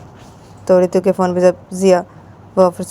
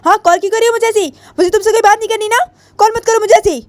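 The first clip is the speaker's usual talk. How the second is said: angry; faster than usual. Acted. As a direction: tell off the caller, high and fast. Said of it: "वो गुस्से में अपने phone को लेती है". (गुस्से में ऊँची आवाज़)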